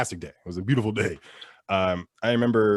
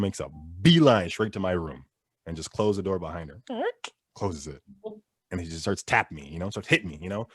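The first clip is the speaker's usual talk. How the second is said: ordinary talk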